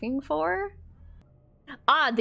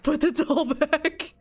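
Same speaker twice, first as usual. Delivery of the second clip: higher, louder, breaking into laughter; laughing plea; 6 semitones higher, 5 dB louder than usual. Real, not acted. Player begging the game character to drop the doll, laughing plea. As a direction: repeat it urgently through a laugh.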